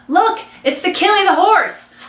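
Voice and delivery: put-on high-pitched, excited boy's cry; edge of excitement. A narrator's reading. Bean suddenly spots the old man's carriage, excited. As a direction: call out a sudden excited sighting. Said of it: "an edge of excitement in his voice".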